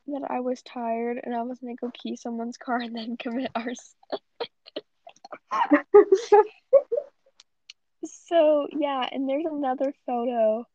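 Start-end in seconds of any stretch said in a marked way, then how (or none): 5.0-6.5 high loud burst of laughter. Real, not acted.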